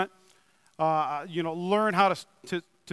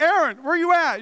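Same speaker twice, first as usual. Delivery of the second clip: mock shout, higher and louder; calling out; 8 semitones higher, 5 dB louder than usual. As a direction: call out as if searching the room. Calling out for missing helper.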